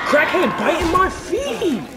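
panicked shout. Real, not acted. Sudden attack in game.

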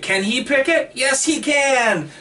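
high catchphrase chant, drawn-out answer; Bob the Builder. Acted. Co-host explaining his pun to his podcast partner, high Bob the Builder chant. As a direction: call it out high, stretching the answer. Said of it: "like Bob the Builder".

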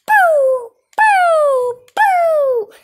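high, loud falling "pew" shots; imitated gun blasts. Player firing the camera gun, imitated blasts. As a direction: fire a toy blaster with the voice.

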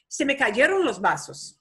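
"cayeron" stressed, then the voice falls; Spanish statement, tune falls at the end; falling statement tune. Shown as a demonstration. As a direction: say it as a flat fact, pitch dropping at the end. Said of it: "pitch of your voice start going high and goes down".

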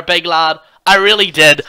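ordinary talk, nothing marked

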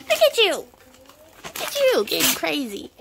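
high excited cooing. Real, not acted. Cooing at the toddler.

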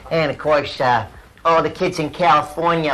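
ordinary talk

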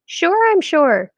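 high, slow, drawn-out insistence; insistent rabbi. A narrator's reading. Rabbi insisting to Shmuel, slow and high. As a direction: lean on each sure.

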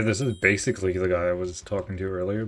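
ordinary talk

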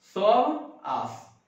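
"सब आस" stretched very slowly, each sound held long; slow, deliberate "सब आस" demo; drawn out. Shown as a demonstration. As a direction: drag out "सब आस" slowly to show the other meaning. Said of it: "देखो".

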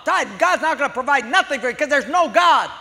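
high, loud yelling; angry shout. Acted. Atheist neighbor shouting there is no God. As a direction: shout her down. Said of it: "This guy's saying".

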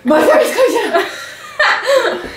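high, slow, strangled laugh. Real, not acted. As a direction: squeak it through laughter. Laughing through the burn.

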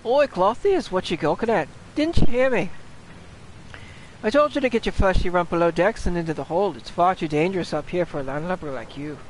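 bossy shipmate calling out; scolding sailor. Acted. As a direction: tell off a landlubber in pirate voice. Voices gruff sailor scolding the player.

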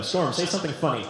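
ordinary talk

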